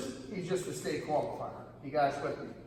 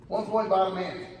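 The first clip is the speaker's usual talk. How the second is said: ordinary talk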